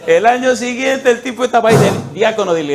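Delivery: loud, high, triumphant proclamation; excited preaching. Real, not acted. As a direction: shout the good news with joy. Triumphant sermon climax. (predicación exaltada y fuerte)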